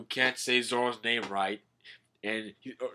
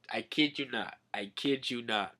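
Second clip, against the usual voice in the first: normal talking voice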